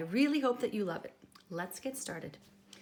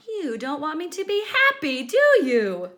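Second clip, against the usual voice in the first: louder sulky accusation; accusing pout; 7 semitones higher, 13 dB louder than usual. Acted. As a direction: turn it on the reader with a pout. Pigeon sulking accusation.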